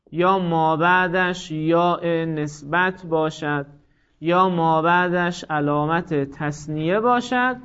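very slow, word by word, pauses between items; slow dictation. Shown as a demonstration. Teacher listing the four cases, very slow dictation. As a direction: read the list slowly for students to copy. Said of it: "یک چیه؟".

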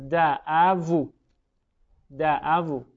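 دعوو said very slowly, long open vowel stretched; drawn-out example word. Shown as a demonstration. Teacher giving an example word, slow careful دعوو. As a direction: stretch دعوو slowly so each sound is heard. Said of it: "مثلاً فرض کنید".

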